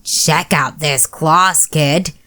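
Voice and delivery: a slow rasped growl from under the bed; rasping monster. A narrator's reading. Third monster showing off claws to the kid, slow rasping. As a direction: rasp it out slowly, a little menacing. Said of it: "a third voice ... rasped".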